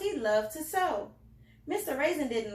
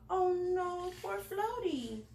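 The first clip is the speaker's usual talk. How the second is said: sympathetic; 6 semitones higher, slower than usual. A narrator's reading. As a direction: feel sorry for the little dog. Narrator pities the dog.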